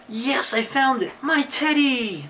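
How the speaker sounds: high joyful cry, stretched at the end; excited exclamation. Acted. Eddie finds his teddy, exclaiming excitedly. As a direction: exclaim it high, thrilled at the find. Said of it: "He's exclaiming something".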